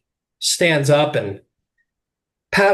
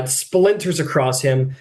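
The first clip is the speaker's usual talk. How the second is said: ordinary delivery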